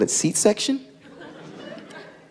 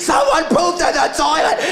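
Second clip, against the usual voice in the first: high-pitched horrified announcement; mock screaming; 13 semitones higher than usual. Manager alerting the store about the toilet, high mock screaming. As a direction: wail it out in mock horror. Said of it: "he's screaming".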